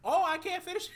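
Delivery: put-on high, fast old-timey kid voice; squeaky newsreel-era boy; 1930s cartoon. Joining old-timey boy bit.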